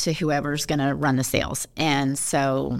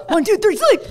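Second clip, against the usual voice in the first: high, loud barked countdown; Marine-style lights out; 9 dB louder than usual. Retold or imitated. Dad calling lights-out to the girls, barked drill command. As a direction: bark it like a drill sergeant. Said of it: "that's like the Marine Corps".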